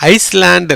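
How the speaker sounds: ஐஸ்லாண்ட் with the S sounded; ஐஸ்லாண்ட் said "ice-land", the mistake to avoid; wrong pronunciation. Shown as a demonstration. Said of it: "இல்ல".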